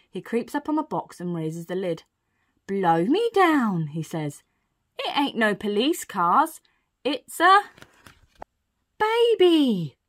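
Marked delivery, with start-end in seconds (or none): 2.7-3.9 loud, long-drawn astonishment; storybook burglar taken aback, slow and loud; astonished exclamation. Acted. Burglar astonished on lifting lid. 5.0-10.0 building to a high, loud reveal; amazed storybook burglar; astonished discovery. Acted. Burglar discovers baby in the box.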